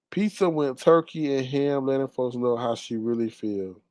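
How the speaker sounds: ordinary delivery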